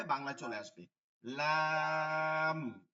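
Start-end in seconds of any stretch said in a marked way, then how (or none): 1.2-2.9 very slow, stretched লাম; লাম held long and clear, not লে; drawn-out lam. Shown as a demonstration. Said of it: "একজন বলছেন লাম, এই লে যেন না হসে". (লাম টেনে লম্বা উচ্চারণ)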